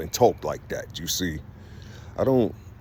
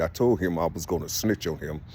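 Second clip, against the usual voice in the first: ordinary delivery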